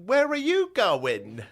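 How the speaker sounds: put-on high, slow, drawn-out old lady screech; mock the old woman calling after his car; shrill old lady. Old woman in the parking lot, mock high screech. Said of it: "She's like".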